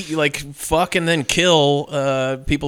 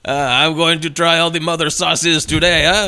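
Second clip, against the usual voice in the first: put-on slow, hammy French accent; badly faked French with a seducer's air; terrible French accent; slower than usual. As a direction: play a cartoon Frenchman. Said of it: "doing this terrible French accent".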